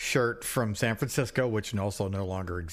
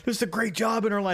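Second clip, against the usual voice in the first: imitating panicked friend; 8 semitones higher, faster than usual. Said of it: "And Rocco's like".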